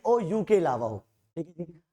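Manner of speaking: normal talking voice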